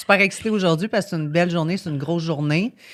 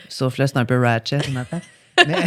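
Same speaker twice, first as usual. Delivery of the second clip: usual speaking voice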